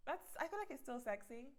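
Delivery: ordinary talk, nothing marked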